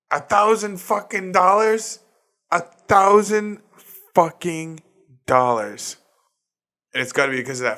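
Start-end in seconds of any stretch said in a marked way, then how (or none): 0.1-6.0 high, loud incredulous outburst; stretched-out outraged squeal; outraged disbelief. Real, not acted. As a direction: shriek it in disbelief at the price. Outraged at repair cost.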